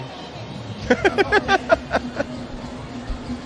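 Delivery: high breathless giggle that rolls on; long giggling laugh. Real, not acted. Commentator giggling about colleagues' outfits, long high laugh. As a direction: let the laugh run and fade.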